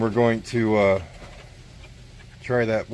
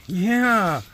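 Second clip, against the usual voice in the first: high cooing yeah; 9 semitones higher than usual. Real, not acted. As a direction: coo it to the dog. Cooing at the dog.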